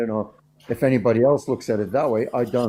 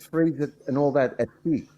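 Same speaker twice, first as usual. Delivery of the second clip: ordinary talk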